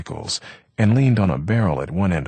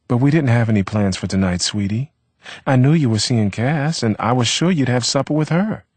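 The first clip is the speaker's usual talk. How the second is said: put-on higher, louder, sweet and breezy girlfriend; imagined girlfriend's voice; 6 semitones higher, 4 dB louder than usual. A narrator's reading. Vivaldo imagines his girlfriend excusing her absence, sweetly. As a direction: play her lightly dismissive excuse. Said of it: "And she would say".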